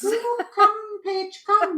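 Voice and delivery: high, loud beckoning voice of the hills; a sing-song siren call from the hills. Retold or imitated. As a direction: call her home like the hills themselves. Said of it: "the European hills are calling me".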